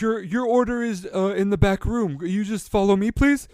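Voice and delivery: anxious high hippo voice; nervous shopkeeper. Acted. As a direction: pretend there's an order, voice tight with fear. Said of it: "He is terrified".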